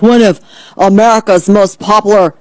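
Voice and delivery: imitating someone online in an odd put-on voice; mock the poster with affected vowels. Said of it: "why I'm talking like this".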